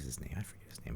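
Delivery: whisper. Real, not acted. Whispering while trying to remember.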